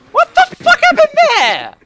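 high, loud, slow disbelief; shocked shout. Real, not acted. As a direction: scream it in shock. Sudden crash in game.

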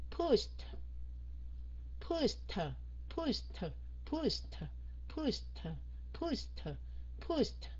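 each PUSHED ends on an audible T; final T released. Shown as a demonstration. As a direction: drill Pushed, popping the final T. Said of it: "Các anh chị nghe cái âm T không". (Pushed, bật rõ âm T cuối)

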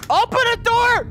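urgent shout. Real, not acted. Trapped, door jammed.

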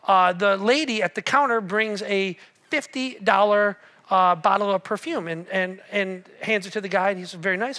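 usual speaking voice

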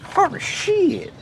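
high, loud, exaggerated caricature of a scolding wife; mock nagging wife. Husband imitating his wife over cut tomatoes, hammed-up high scolding. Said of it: "that's my best impression, most offensive impression".

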